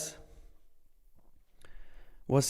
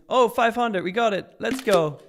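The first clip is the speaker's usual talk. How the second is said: loud, raised victory cry; thrilled streamer cheer; excited celebration; 5 semitones higher, 6 dB louder, slower than usual. Real, not acted. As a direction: celebrate hitting the milestone, loud and high. Viewer goal reached, celebrating.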